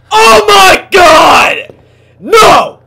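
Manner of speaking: very high, loud shriek; real fright, loud and high; terrified scream. Real, not acted. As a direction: scream as the thing appears. Monster appears again.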